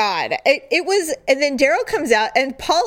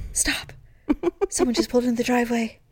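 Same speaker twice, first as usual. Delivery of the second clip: urgent hush; 5 dB quieter, faster than usual. Retold or imitated. Hiding from a car in the driveway.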